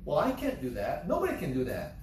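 normal talking voice

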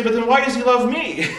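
laughing disbelief. Acted. Incredulous question, laughing.

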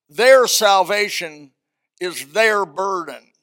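slow, each "their" pressed hard; deliberate repeated "their" emphasis; stress on THEIR. Shown as a demonstration. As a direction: hammer every "their" slowly. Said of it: "Let me emphasize the word "their".

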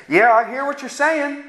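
usual speaking voice

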